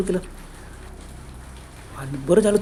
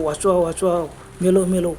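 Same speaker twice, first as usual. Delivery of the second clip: ordinary delivery, nothing marked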